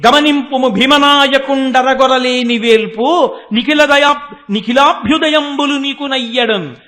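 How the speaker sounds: slow, sustained declamation; loud verse recitation. Retold or imitated. Goddess urging Vyasa toward Bhimanatha, loud drawn-out verse.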